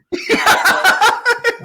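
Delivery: big burst of laughter; loud laugh. Real, not acted.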